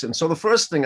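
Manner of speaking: normal talking voice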